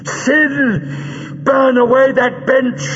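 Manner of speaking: very high snarled order; high, fierce villain's command. A narrator's reading. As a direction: snarl the command at your henchman. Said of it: "Greel snarled".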